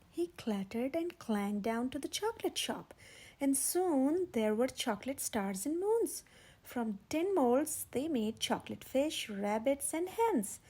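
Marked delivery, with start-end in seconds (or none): none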